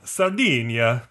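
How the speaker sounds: slow Italian-accented "Sardinia". Shown as a demonstration. Giving in to friend's demand, slow Italian accent on "Sardinia". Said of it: "Say it in your Italian voice".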